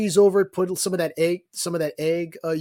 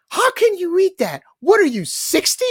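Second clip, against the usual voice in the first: much higher, louder put-on voice of disbelief; mock outraged friend; 7 dB louder than usual. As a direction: squeal it like an outraged buddy. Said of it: "he goes".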